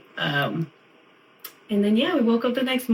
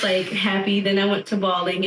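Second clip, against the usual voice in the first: normal talking voice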